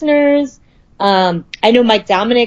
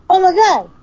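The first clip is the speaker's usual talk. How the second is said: higher-pitched imitation of a Boston-Scottish accent; Bostonian-Scottish accent; 8 semitones higher than usual. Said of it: "in his Bostonian slash Scottish accent".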